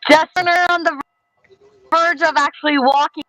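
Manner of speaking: high, slowed-down glitchy call audio; sped-up then dragging chipmunk sound. Real, not acted. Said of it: "You sound like a chipmunk that's going slow".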